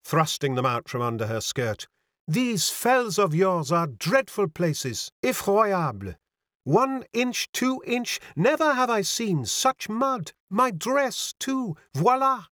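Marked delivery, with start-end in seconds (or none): 2.3-12.5 put-on high, slow French-accented girl; French young lady, pitched well up; mincing French accent. A narrator's reading. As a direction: ask daintily with a French accent. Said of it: "with a touch of the mincing French accent".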